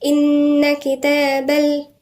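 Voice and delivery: إن with a doubled nun held nasal, slow; ghunna on إن. Shown as a demonstration. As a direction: stretch the nun in إن. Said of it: "مد طبيعي".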